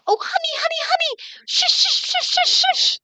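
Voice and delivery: high soothing mother hushing; bouncing a baby. Acted. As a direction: hush a baby while bouncing it. Mother hushing over a message.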